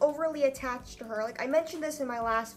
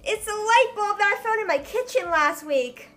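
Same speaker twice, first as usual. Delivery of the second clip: higher, louder over-bright presenter voice; mock vlog intro; 7 semitones higher, 6 dB louder than usual. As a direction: ham up a bubbly youtuber opening fan mail. Parody vlog intro opening fan mail.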